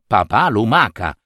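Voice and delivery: ordinary delivery, nothing marked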